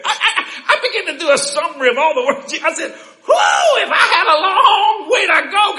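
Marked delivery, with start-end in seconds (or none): 2.9-5.8 high, loud mock-daunted whoop; exclaiming, overwhelmed. Preacher retelling his reaction to God's command, high exclamation. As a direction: cry out at the size of the task.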